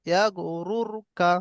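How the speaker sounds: very slow, every syllable drawn out; slow recitation. Shown as a demonstration. Teacher reciting the example slowly for students. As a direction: draw out yeah গরুরকা syllable by syllable. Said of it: "র কে আমরা পুর পড়ব".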